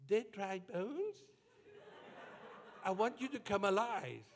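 soft, high, tentative voice; small, timid voice addressing the bones; timid command. Shown as a demonstration. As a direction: say it meekly, high and quiet. Timid take before urging boldness.

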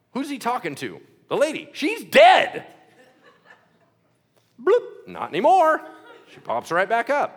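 ordinary delivery

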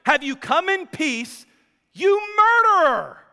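high, loud accusing cry building to a yell on murderer; Jezebel's outraged shout from the window; acted shout. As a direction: shout it accusingly, peaking on the last word. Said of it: "she shouts at him".

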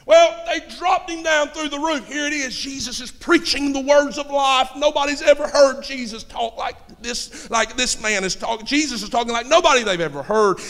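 high-pitched preacher's narration; impassioned pulpit storytelling, easing toward the end; fired-up preaching. Acted. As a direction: keep the sermon rolling at a high pitch.

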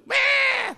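put-on very high, loud baby wail; piercingly high imitated bawl; mock baby cry. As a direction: squeal out a pretend infant's cry. Imitating a baby crying for care.

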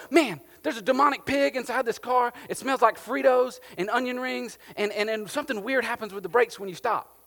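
high, loud, exasperated customer; hammed-up outrage at a bad purchase; indignant complaint. Buyer returns complaining about car.

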